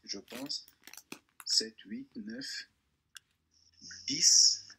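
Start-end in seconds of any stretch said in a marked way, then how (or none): 1.5-4.7 building count. Acted. Counting with mounting drama.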